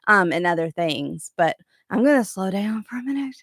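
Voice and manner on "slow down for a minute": soft whisper, nearly breath. Real, not acted. Vlogger telling viewers she needs a breather, whispering. Catching her breath on camera.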